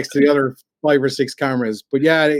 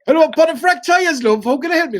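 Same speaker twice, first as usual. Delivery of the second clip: high, loud comic Yorkshire receptionist; hammed-up Yorkshire voice; stupid Yorkshire accent; 7 dB louder than usual. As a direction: play a daft Yorkshire garage worker answering the phone. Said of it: "we'd put on some stupid accent".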